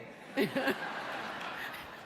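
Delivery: breathy burst of laughter. Real, not acted. Telling the crowd about Barkley, a burst of laughter. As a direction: laugh through the story.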